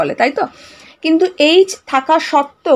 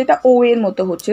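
usual speaking voice

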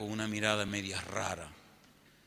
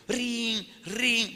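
high ringing doorbell noise; imitated doorbell; 13 semitones higher, 6 dB louder than usual. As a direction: mimic a doorbell with two high rings. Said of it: "volví y soné el timbre".

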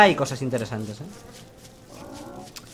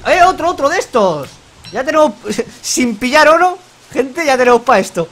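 excited; 6 semitones higher, 5 dB louder than usual. Real, not acted. Excited at loot found.

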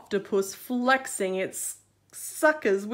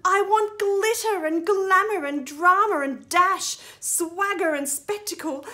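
strut it out like a star finally stepping onstage; proud crab hero; 5 semitones higher, 5 dB louder than usual. Acted. Crab tells his friends he is done being dull, grandly.

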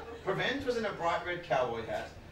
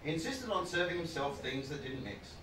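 ordinary talk

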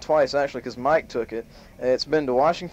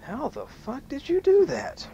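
high, slow, drawn-out astonishment; slow high incredulous question; amazed disbelief; 12 semitones higher than usual. Acted. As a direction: stretch it out in wonder, pitched up.

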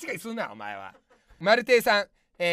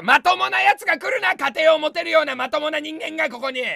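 mock outrage; 4 semitones higher, 7 dB louder than usual. Mock-angry reaction to comment.

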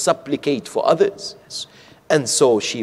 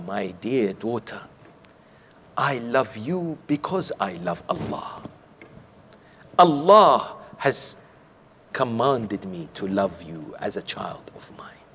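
much softer, hushed, fading into a whisper; tender fatherly reply; 5 semitones lower, 6 dB quieter than usual. Retold or imitated. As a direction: speak to a beloved daughter gently. Father answers beloved daughter tenderly.

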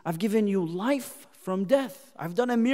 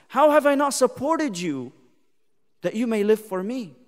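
ordinary talk

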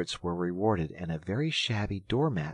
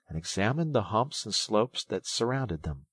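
ordinary delivery, nothing marked

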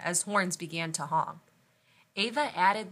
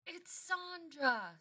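held-back fan scream; 9 semitones higher, 7 dB quieter, much slower than usual. Acted. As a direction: squeal it softly like a starstruck fan. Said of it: "someone suddenly screamed".

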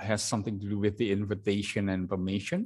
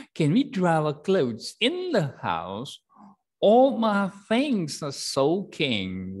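higher, louder, slow complaint about soaking things; soaked camper pleading; 8 semitones higher, 6 dB louder, slower than usual. Shown as a demonstration. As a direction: plead like someone drenched. Teacher voices soaked camper.

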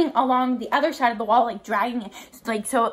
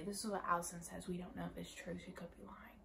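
very low, soft aside sinking to a whisper; mock-secretive undertone, fading away; hushed aside; 5 semitones lower, 21 dB quieter than usual. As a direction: confide it as if she mustn't hear. Joking confidential aside to audience.

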